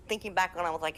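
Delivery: usual speaking voice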